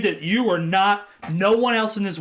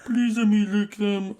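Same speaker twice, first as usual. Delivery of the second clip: high, slow excited dog voice; playful dog voiced high; dog's voice; 8 semitones higher, much slower than usual. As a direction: voice an eager dog begging. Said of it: "he'd be like".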